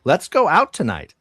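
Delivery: flirty toy doll line; talking doll. Acted. GM voices the doll.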